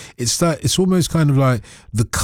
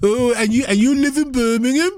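a hint of Brummie accent, pitched up, teasing; mock Brummie indignation; 9 semitones higher than usual. Mock-defending Birmingham accent.

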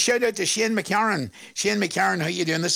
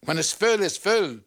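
normal talking voice

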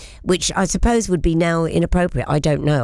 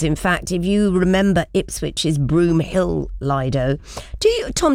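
ordinary talk

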